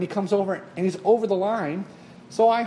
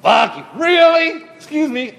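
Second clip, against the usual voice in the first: high loud slow sarcastic fuck you, really; sarcastic horn; 11 semitones higher, 9 dB louder than usual. Pretend. Friends inventing a sarcastic horn setting, loud and sarcastic. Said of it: "a sarcastic setting".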